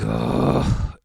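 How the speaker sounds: breathy exasperated "ugh"; exasperated groan. Retold or imitated. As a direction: let out a low, fed-up sigh. Exasperated reaction to dropped Coke.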